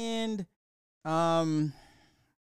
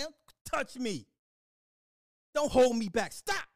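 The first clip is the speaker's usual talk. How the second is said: mock angry yell at her, higher; 8 semitones higher than usual. Yelling at the woman holding him back, mock angry. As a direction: shout her off, hammed up.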